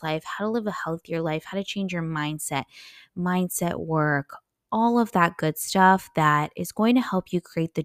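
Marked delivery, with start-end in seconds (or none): none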